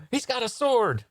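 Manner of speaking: high-pitched theatrical panic; alarmed cry. Acted. Onlooker warning that he's got a sword, alarmed. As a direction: cry out the danger in mock horror.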